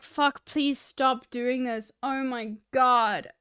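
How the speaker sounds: pained cringing. Retold or imitated. Streamer recalling watching a hyper cringe moment, pained cringing. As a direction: draw it out under your breath, pleading. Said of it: "I literally was sitting there being like".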